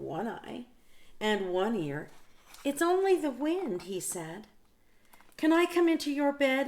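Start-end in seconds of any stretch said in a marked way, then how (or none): 2.6-3.8 lightly voiced Big Mouse, higher, calming. Big Mouse reassures Little Mouse. 5.4-6.7 high, small pleading mouse voice; a tiny mouse begging, pitched up; Little Mouse voice. Acted. Little Mouse asks Big Mouse.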